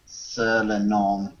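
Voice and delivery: hammed-up fake French accent; lightly done mock French accent; bad French accent. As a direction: ham up a cartoon French accent, slowing on the French. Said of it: "read this spam in a bad French accent".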